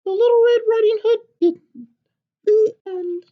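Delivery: high, loud, eager chick's squeak; a tiny excited hen butting in; little chicken voice. Acted. Chicken bursting into papa's story, high and eager.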